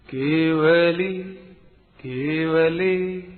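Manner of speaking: केवली slowly, with a full clear व in the middle; patient teacher repeating केवली; careful pronunciation. Shown as a demonstration. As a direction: stretch केवली so each sound is heard. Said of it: "फिर से सुनिए, sound पर ध्यान देना".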